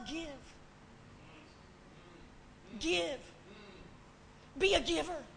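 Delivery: ordinary delivery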